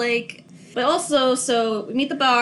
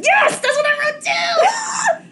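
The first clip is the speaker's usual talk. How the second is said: much higher and louder, yelling in delight; excited shout; 5 dB louder, slower than usual. Real, not acted. Shouting back to the co-host, thrilled. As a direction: burst out with real glee.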